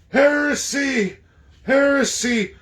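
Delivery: high, loud, slow cry of heresy; mock outrage. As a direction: denounce it like a scandalised zealot.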